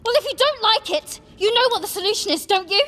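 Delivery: high furious yell. A narrator's reading. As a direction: scream it at him, furious. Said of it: "yelled Hermione".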